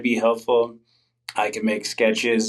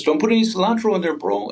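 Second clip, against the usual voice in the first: high, fast bro voice; guy at the taco truck; 9 semitones higher, faster than usual. Retold or imitated. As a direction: rattle it off high like a chill bro. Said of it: "he goes".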